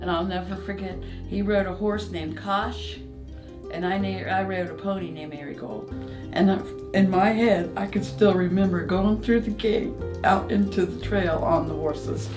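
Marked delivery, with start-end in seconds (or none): none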